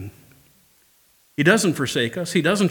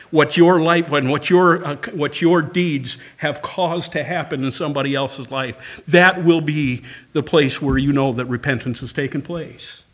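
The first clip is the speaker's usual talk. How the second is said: ordinary talk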